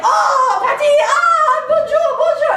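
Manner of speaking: high, loud squealed hellos; shrill mock French greeting. Staff gushing hellos to each other, squealed high and loud.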